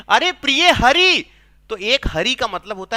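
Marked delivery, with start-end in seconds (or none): 0.1-1.2 higher, louder, drawn-out reply from outside; Krishna calling out. Acted. Krishna answers Radha from outside the door, calling out. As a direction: announce yourself slowly to your beloved. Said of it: "बाहर से भगवान श्री कृष्ण बोलते हैं".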